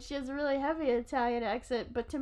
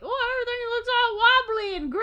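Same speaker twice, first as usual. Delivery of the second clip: high, loud wobbly exclamation; mock amazed; 11 semitones higher, 6 dB louder than usual. Someone peering through clear Jell-O, mock amazed. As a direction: marvel at it in a high put-on voice.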